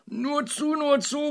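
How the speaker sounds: very high, loud, gleeful urging; eager calling out. A narrator's reading. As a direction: egg them on excitedly. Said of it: "rief der Bauer und rieb sich erwartungsfroh die Hände".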